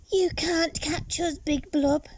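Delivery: high, bold taunting call into the dark. Acted. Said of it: "called out into the shadows".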